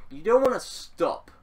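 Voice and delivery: ordinary talk, nothing marked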